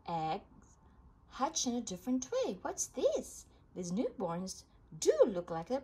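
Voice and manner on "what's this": much higher, puzzled what's this; surprised question. A narrator's reading. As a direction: sound amazed at the sight. Surprise at newborns.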